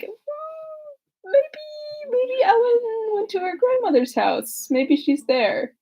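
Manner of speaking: hammed-up whimpering high voice of a lovestruck man; whiny Newland. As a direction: squeak it out like a pining suitor, half crying. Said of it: "Newland was like".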